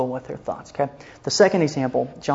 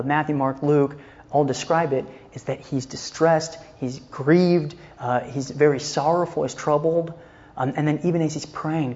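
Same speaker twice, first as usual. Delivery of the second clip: ordinary talk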